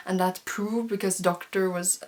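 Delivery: normal talking voice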